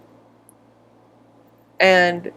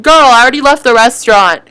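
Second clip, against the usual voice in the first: louder, fast sassy comeback; sassy retort; 4 semitones higher, 6 dB louder, faster than usual. Acted. Customer to waiter, fast sassy comeback. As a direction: snap back with attitude.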